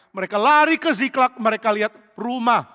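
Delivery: ordinary delivery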